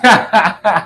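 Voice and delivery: short burst of laughter; real laugh. Real, not acted. Teacher reading comments about their voice, laughing. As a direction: laugh in a quick run.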